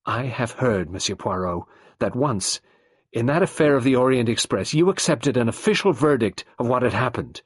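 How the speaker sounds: wife passionately reminding the detective of his past. A narrator's reading. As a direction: throw his own past back at him. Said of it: "Nadine said passionately".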